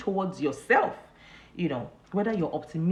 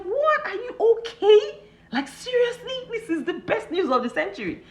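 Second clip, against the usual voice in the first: much higher, loud, incredulous; mock outrage at good news; shocked disbelief; 5 dB louder than usual. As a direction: act out your amazed friend. Retells her own shocked reaction.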